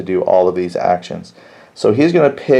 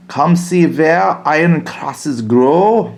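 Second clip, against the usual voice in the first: hammed-up German accent, higher and drawn out slowly; poor German accent; 6 semitones higher, slower than usual. Meyer rallying all German soldiers, in a poor German accent. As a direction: shout a rally to the troops in a clumsy fake German accent. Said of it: "That's my poor German accent".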